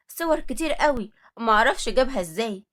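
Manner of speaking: ordinary delivery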